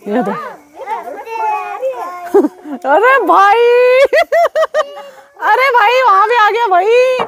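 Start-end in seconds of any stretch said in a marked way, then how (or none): 0.3-7.2 laughing excitedly. Real, not acted. Vlogger laughing at the sparks reaching them, laughing excitedly. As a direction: crack up as the sparks fly.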